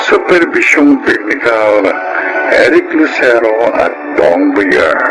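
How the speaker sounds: ordinary talk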